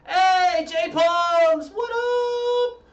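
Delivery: high, loud, drawn-out sing-out hail; shouted greeting. Real, not acted. Streamer hailing a friend joining chat, shouted greeting. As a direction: call out high and loud, holding each phrase.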